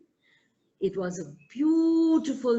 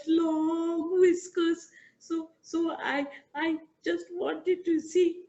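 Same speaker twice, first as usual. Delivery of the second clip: much higher squeaky mouse voice, stuttering in fear; a tiny mouse begging with chattering fear; frightened mouse pleading with the lion; stammering scared mouse; 7 semitones higher than usual. Acted.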